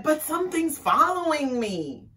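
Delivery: high, loud, alarmed wail; frightened wolf. A narrator's reading.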